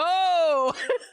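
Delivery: loud high shout of laughter. Real, not acted. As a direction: burst out laughing loudly. Reacts to character creator.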